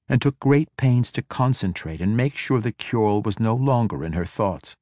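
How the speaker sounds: usual speaking voice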